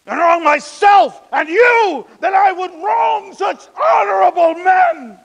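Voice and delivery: high, emphatic declamation building to a climax; impassioned oratory. Acted. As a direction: throw it out at the crowd with scorn.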